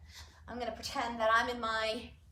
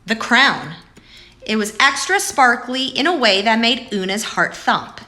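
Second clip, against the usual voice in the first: louder, lively storybook voice; reading to children; 19 dB louder than usual. Acted. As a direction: read aloud to the kids, full and clear. Reads picture book aloud.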